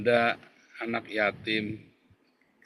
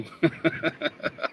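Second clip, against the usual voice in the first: breathy laugh; 5 semitones higher than usual. Real, not acted. Laugh after mock threat.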